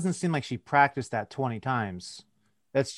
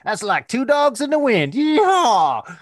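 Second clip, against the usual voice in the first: high, loud mock hillbilly with a yeehaw; hammed-up Southern yokel; 11 semitones higher, 6 dB louder, slower than usual. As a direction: ham up a cowboy slapping his knee. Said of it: "If they slap their knee".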